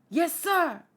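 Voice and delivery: high, snappy soldier's reply; acted military yes-sir; soldier's acknowledgement. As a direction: answer the order loud and high. Subordinate answers an order.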